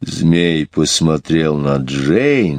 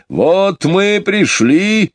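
high, slow joyful exclamation; exclaiming; 13 semitones higher, much slower than usual. A narrator's reading. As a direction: call out the arrival happily. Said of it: "воскликнул бурый медведь". (радостное восклицание)

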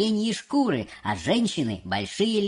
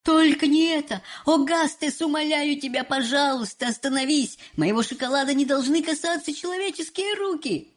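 horrified exclamation; 7 semitones higher than usual. Acted. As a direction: plead in alarm with the boy. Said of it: "в ужасе воскликнул".

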